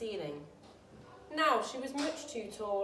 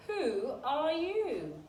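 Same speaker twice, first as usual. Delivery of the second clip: slow, drawn-out caterpillar voice; grumpy caterpillar; 5 semitones higher, much slower than usual. A narrator's reading. Caterpillar slowly asking Alice who she is. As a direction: stretch out the question lazily. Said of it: "he said".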